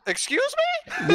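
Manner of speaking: very high, drawn-out disbelief with a laugh; mock incredulous. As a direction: squeak it out slowly, half laughing. Mock reaction to racist excuse.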